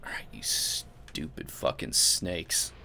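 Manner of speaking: hushed whispered curse at the snakes. Real, not acted. Gamer whispering at snakes in the game, hushed. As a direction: mutter it under your breath, sneaking.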